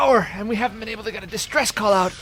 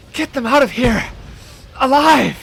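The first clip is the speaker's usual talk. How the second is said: building from firm to a loud shouted plea; desperate shout; 4 semitones higher, 6 dB louder, slower than usual. Acted. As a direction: push the last word out with everything left. Dying mayor pleads with captain.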